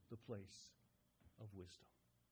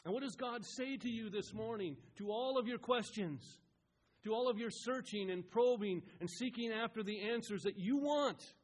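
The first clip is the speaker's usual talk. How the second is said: raised, insistent pulpit challenge; impassioned preaching; 6 semitones higher, 5 dB louder than usual. Real, not acted. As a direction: press the congregation, building to a loud high peak.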